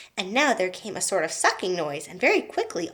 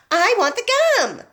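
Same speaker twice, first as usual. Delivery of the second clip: high, loud stubborn demand; obstinate; 11 semitones higher, 8 dB louder than usual. A narrator's reading. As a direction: insist like a spoiled girl. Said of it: "said Violet obstinately".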